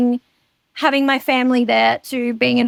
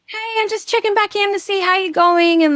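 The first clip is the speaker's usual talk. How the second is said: chirpy Erin; 8 semitones higher than usual. Retold or imitated. Quoting Erin's check-in text.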